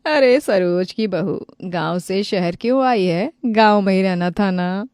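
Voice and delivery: loud, slow taunting voice of a neighbour; mocking neighbour. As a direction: jeer at the new daughter-in-law. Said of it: "पड़ोसन गीता मजाक बनाने लगती है". (ताना मारती पड़ोसन)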